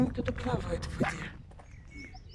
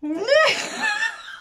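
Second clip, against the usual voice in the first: shrieking laugh; 6 dB louder than usual. Real, not acted. Laugh burst after rant.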